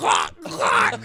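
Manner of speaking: high strangled mock cries; being choked out while swinging at a friend; choked yell. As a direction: squeal like you're being choked. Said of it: "And I'm like".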